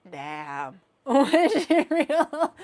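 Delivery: usual speaking voice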